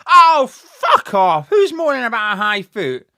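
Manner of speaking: a loud, slow, scornful oh fuck off; angry outburst. Real, not acted. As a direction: snap back at the whiners. Annoyed at opposing complaint.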